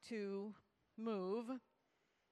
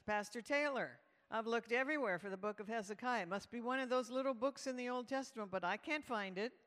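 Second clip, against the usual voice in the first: quick, louder imitation of a baffled leader; urgent puzzlement; 3 semitones higher, 5 dB louder than usual. Leader asks the pastor about a missing book, urgent. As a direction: rattle off the complaint, eager for an answer.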